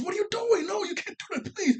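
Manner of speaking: very high-pitched mock panic; hammed-up squeal of alarm; imitated freak-out. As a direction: act out a frantic plea, voice shooting way up. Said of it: "she starts to freak out".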